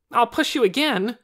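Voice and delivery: high, loud defiant threat; childish threat. A narrator's reading. Torin defies the doctor and Lena, childish threat. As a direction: threaten like an angry small boy. Boy threatens in shoving quarrel.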